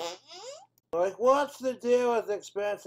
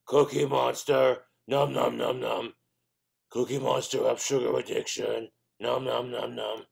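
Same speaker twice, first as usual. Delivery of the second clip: Cookie Monster voice; 3 semitones lower than usual. Acted.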